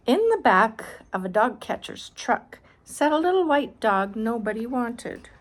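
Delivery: put-on reading aloud to a child, clear and bright; storybook reading voice; story narration. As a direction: narrate the picture book. Reads picture book aloud.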